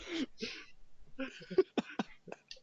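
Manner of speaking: high-pitched, long laugh; helpless high laughter; real laugh. Real, not acted. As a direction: crack up high and breathless at your own story. Laughs at own retold reaction.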